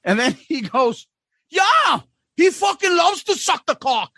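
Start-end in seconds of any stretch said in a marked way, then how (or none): 1.5-4.1 high, excited Aussie holler; mock drunken Aussie shout; loud Australian drunk. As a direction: blurt it out for the whole room. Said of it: "he is not saying this quietly".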